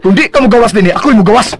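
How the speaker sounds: high, loud ultimatum; wife threatening to leave the room; angry threat. Acted. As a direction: threaten to walk out.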